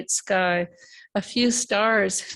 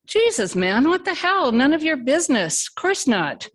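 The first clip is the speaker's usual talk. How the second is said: sharp pitch jump, louder, offended; indignant outburst; 7 semitones higher, 4 dB louder than usual. Acted. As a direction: snap back, stung by the question. Tim flares at the insult.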